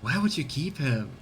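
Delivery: usual speaking voice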